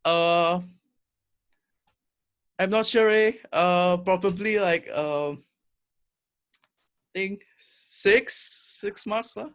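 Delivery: slow, unsure Singlish reply; playful Singlish, hesitant and drawn out; Singlish accent. Pretend. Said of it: "let's exchange a few sentences in Singlish".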